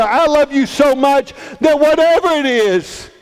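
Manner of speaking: high, fervent declaration of love; impassioned pleading. Acted. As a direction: plead with rising fervour. Joseph pleads earnestly with Mary.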